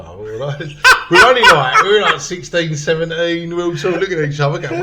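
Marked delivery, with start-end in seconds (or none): none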